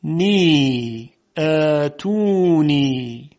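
"نی، ا، تو، نی" with every vowel held long; stretched syllables. Shown as a demonstration. Teacher sounding out a word, "نی، ا، تو، نی" stretched. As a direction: draw out each syllable of "نی، ا، تو، نی". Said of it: "به این کلمه دقت کنید".

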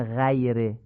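'غیره' as 'ghayre', thin front 'a'; Persian-style light vowel in 'غیره'; wrong light fatha. Shown as a demonstration. Teacher showing what we don't say, thin 'غیره'. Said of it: "نمیگیم".

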